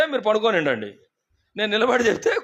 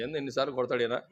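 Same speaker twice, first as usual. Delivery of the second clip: muttered aside; 7 semitones lower, 9 dB quieter, faster than usual. Retold or imitated. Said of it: "అనుకుంటది".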